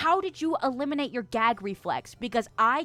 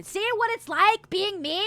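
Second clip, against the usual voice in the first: whiny complaint; 9 semitones higher, 5 dB louder than usual. Real, not acted. Self-deprecating rant.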